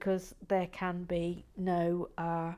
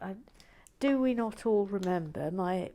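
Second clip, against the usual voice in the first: ordinary delivery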